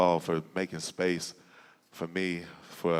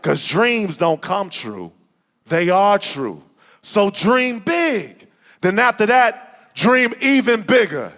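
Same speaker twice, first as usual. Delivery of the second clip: spoken word poem; 13 semitones higher, 12 dB louder than usual. Acted. Closing lines of the poem.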